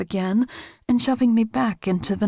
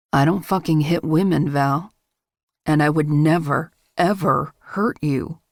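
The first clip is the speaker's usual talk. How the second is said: lowered male voice, firm then slowing; low insistent man; 7 semitones lower than usual. A narrator's reading. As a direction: voice him low, swearing he never would. Man insists he won't hurt her.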